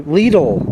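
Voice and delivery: "Lidl" said as LEE-dul; "Lidl" rhyming with "needle"; long-ee store name. Shown as a demonstration. Offers store name in variants.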